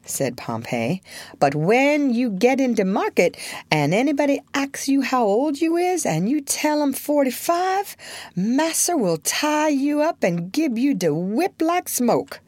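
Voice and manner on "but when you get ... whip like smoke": brisk, bossy servant voice; lightly voiced commanding servant; slave dealer's helper. Pompey voiced in story.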